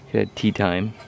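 ordinary delivery

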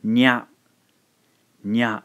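"nya" as a single demo syllable, no i sound; blended nya. Shown as a demonstration. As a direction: blend "nya" into one quick beat. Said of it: "combine these two syllables".

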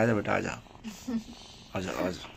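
soft, low coaxing of a pet. Real, not acted. Man softly coaxing the kitten to come down. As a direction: gently coax the kitten down.

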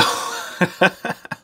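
breathy, mostly voiceless chuckling; winding-down laugh. Real, not acted. Still laughing afterwards.

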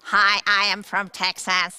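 loud Texan accent with twang; Texas twang. Shown as a demonstration. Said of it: "Dat is twang".

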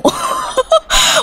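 shrieking giggle at something cute; high delighted squeal. Real, not acted. (귀여워서 터지는 비명 웃음)

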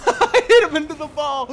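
loud words bursting out through laughter; laughing. Real, not acted. Player who bumped someone into the ball, laughing. Laughing at own accidental bump.

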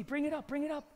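ordinary talk

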